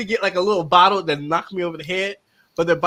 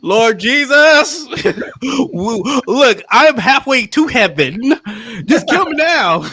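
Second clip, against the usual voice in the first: mock desperate prayer; 3 semitones higher, 5 dB louder, slower than usual. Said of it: "I start calling on Jesus".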